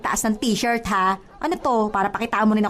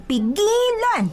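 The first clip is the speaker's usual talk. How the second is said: stretched exclamation; 10 semitones higher, much slower than usual. Acted. Self-scolding to resist temptation.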